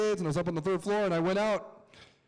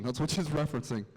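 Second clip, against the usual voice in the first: ordinary talk, nothing marked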